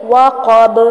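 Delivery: وقب with a heavy qaf and echoing final ba; وقب with qalqala; bouncing ba. Shown as a demonstration. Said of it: "کلکلا کریں گے".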